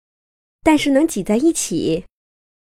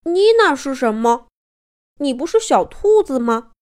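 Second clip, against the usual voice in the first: mildly bewildered little bear; a touch of childlike puzzlement; naively puzzled; 3 semitones higher than usual. A narrator's reading. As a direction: ask with innocent confusion. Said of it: "小熊懵懵懂懂地说".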